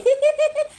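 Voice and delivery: sudden squealing laugh; high shriek of laughter. Real, not acted. Vlogger delighted by the good news, high shriek of laughter. Laughing at her own cleverness.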